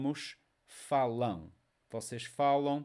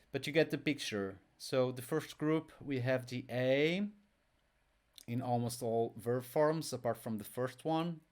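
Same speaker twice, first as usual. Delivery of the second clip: usual speaking voice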